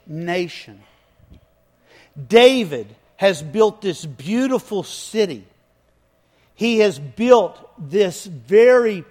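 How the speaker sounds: full-force preaching. Acted. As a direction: preach it big to the back row. Preaching David's story at full force.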